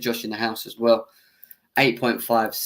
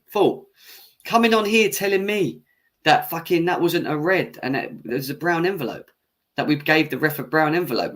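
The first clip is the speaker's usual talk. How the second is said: ordinary talk, nothing marked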